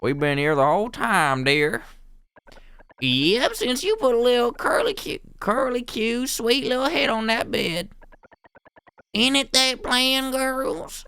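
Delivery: high-pitched, sugary cooing character voice; scheming dear old meddler. Acted. One of three meddlers launching their plan, cooing.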